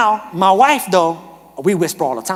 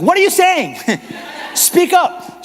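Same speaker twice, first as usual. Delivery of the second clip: exasperated wife; 11 semitones higher, slower than usual. Retold or imitated. Wife who can't hear her whispering husband, exasperated. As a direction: call it out, pitched way up. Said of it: "And she'll be like".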